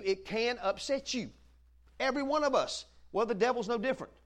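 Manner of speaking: usual speaking voice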